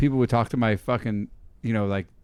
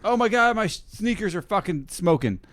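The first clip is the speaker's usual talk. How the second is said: alarmed yelp; 8 semitones higher than usual. Retold or imitated. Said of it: "you're like".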